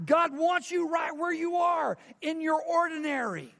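shouted climax of a sermon; preaching shout. Real, not acted. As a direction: proclaim it full force to the church.

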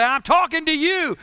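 pointed teacher warning. Retold or imitated. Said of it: "the teacher look at the class or the preacher".

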